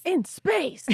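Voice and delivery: high, loud dramatic "In space"; dramatic echo. Pretend. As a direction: echo it like a movie trailer. Echoing the dramatic line.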